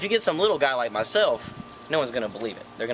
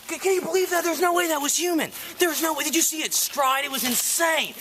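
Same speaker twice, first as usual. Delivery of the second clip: excited; 11 semitones higher than usual. Pretend. Faked excited Bigfoot sighting.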